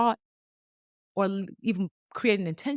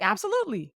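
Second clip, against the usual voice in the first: eager yes; 13 semitones higher, faster than usual. Retold or imitated. Fawn's eager yes, voiced.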